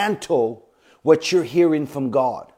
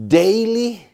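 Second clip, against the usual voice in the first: daily lifted high and loud, drawn out; stressed daily; 6 semitones higher, 5 dB louder than usual. Shown as a demonstration. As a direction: lean hard on daily. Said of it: "I use the word daily".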